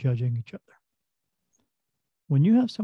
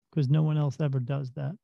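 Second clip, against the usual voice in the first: usual speaking voice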